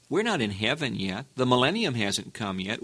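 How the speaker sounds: ordinary delivery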